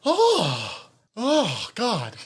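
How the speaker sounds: high, falling cries of disbelief; real astonishment. Real, not acted. Podcast host floored by getting the actress wrong, astonished. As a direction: let the realization hit in high exclamations. Stunned by own mistaken identification.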